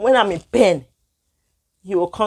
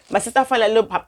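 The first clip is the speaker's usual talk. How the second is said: ordinary delivery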